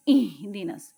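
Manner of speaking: اہدنس from deep inside, slowly; deep throat ha. Shown as a demonstration. Teacher demonstrating اہدنا for students. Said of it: "اندر سے".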